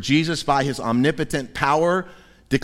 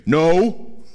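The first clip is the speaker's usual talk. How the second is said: grumpy fishermen; 3 semitones higher, much slower than usual. Retold or imitated. Tired fishermen grumbling back at the stranger on shore. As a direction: brush off the question, fed up. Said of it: "collectively say".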